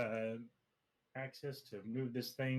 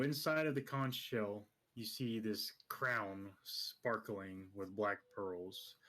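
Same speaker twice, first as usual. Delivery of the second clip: scene setting; slower than usual. Acted. Game master describes scene.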